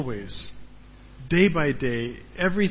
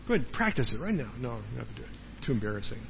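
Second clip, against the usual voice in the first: normal talking voice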